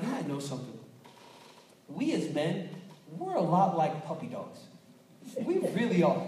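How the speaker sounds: usual speaking voice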